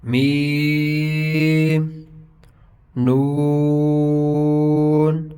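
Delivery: میم and نون with very long held vowels; drawn-out, sustained recitation of میم and نون; elongated letter names. Shown as a demonstration. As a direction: stretch out the vowel in میم and نون, loud and steady. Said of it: "ان کو لمبا بھی کریں گے".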